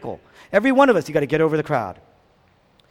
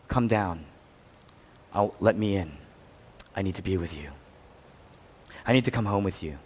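much lower and softer, hushed and gentle; Jesus quietly asking to come home with Zacchaeus; tender Jesus; 10 semitones lower, 6 dB quieter than usual. Acted. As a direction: invite him down softly, with love. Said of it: "he says to Zacchaeus".

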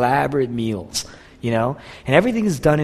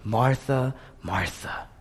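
tender, falling, softer on the second; emotional; 2 semitones lower, 8 dB quieter, much slower than usual. Acted. Preacher voicing Jesus addressing Martha, emotional and hushed. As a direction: let the name sink into a hush. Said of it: "with emotional content".

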